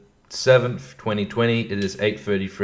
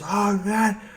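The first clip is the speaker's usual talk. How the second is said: mock angry yell pitched way up; 11 semitones higher, slower than usual. Shown as a demonstration. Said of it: "am I yelling like this?".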